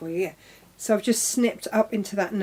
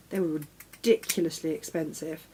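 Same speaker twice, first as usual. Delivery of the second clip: usual speaking voice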